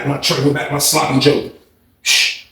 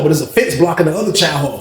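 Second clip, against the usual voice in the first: usual speaking voice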